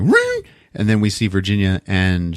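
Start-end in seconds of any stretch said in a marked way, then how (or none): 0.0-0.4 very high-pitched imitated ringing. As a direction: mimic a shrill ring with your voice. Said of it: "And it's like".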